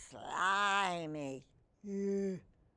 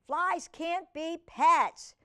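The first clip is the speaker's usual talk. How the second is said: stern dad; 7 semitones higher, 6 dB louder, slower than usual. Acted.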